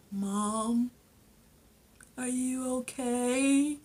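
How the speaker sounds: high, drawn-out mock son voice; slithering Tariq. Son slithers in on crying mom, slow mock concern. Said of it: "here come Tariq slithering".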